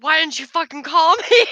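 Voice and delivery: loud, laughing half-angry scold; mock outrage. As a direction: tease her like a miffed coworker. Coworker scolding her, retold.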